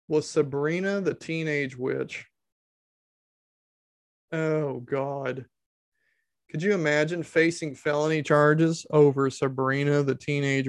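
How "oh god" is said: ordinary talk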